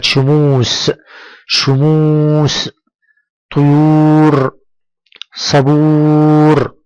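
شموس، طيور، صبور with very long oo; long waw madd. Shown as a demonstration. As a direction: hold each oo on a steady note. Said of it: "المد بالواو". (مدّ الواو طويلًا في شموس وطيور وصبور)

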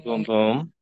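ordinary talk, nothing marked

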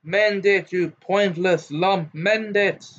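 high-pitched angry yell, drawn out on the insult; screamed order. A narrator's reading. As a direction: scream it at her, not at full volume. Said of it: "Gaunt screamed".

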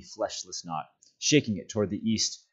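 ordinary delivery, nothing marked

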